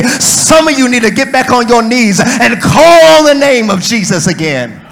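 driving, unbroken sermon delivery; full-force preaching. Real, not acted. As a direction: preach it hard, drawing out the big words.

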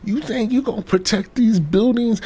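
mocking protester. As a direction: sneer at the kids in a squeaky put-on voice. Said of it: "These protesters like".

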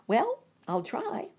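high, slow stork voice; high unsure bird voice. Acted. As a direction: agree doubtfully as the stork. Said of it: "said the stork".

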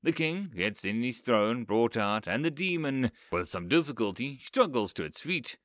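usual speaking voice

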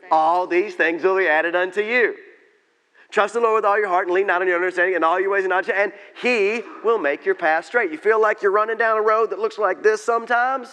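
loud, high-pitched pulpit proclamation; full-force preaching. Real, not acted.

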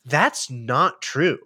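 very slow deadpan denial; slow flat denial. Retold or imitated. Friend correcting her claim, very slow deadpan denial. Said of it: "someone was like".

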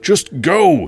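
loud, desperate yell. Acted. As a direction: shout her away in panic. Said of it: "he yelled".

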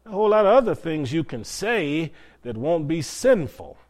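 ordinary talk

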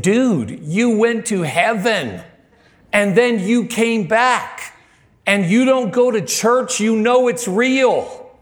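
high, slow, emphatic disbelief; retold exclamation, each phrase stretched and pitched high; incredulous outburst. Preacher challenging a driver who skips church, incredulous.

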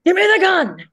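high, loud mock-fierce demand; inner rage. On set as Helen Park, loud mock rage. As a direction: snap it out like a furious agent. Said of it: "so you channel the inner rage".

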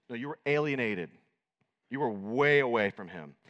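ordinary talk, nothing marked

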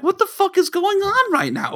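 high, incredulous what-the-hell; baffled outburst. Retold or imitated. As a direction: pitch shoots up in disbelief. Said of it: "I'm like".

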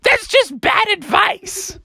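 high, dismissive teacher goose; Mother Goose. Acted. Teacher rejects the mouse's answer, high indignant goose. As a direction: scold in a high falsetto. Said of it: "And she was like".

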